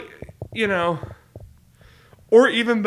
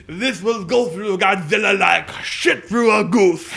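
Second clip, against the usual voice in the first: slow, hammy American dub line; gruff American dub voice; 4 dB louder, slower than usual. Retold or imitated. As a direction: ham up the crude dub line. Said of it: "or the American".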